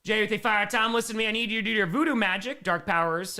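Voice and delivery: normal talking voice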